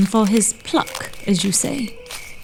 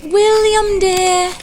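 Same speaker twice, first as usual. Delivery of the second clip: calling out; 8 semitones higher, much slower than usual. Acted. Parents calling for lost son.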